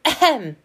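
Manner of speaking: loud, high "ahem"; loud cough. A narrator's reading. Percy waking the sleeping animals, loud throat-clearing. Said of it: "coughed loudly".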